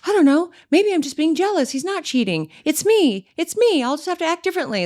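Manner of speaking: high-pitched, self-blaming voice; mock your old eager-to-apologize self; imitated younger self. Mimicking how she used to be with cheaters, high apologetic voice. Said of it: "that's how I used to be".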